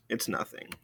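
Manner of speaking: second It's nothing barely whispered. Shown as a demonstration. Teacher softly translating de rien for the class. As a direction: let it fade into a whisper. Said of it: "Which means literally".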